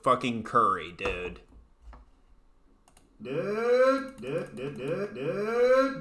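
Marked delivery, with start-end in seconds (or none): 3.2-6.0 exasperated dudes. Real, not acted. Repeated exasperated calling out.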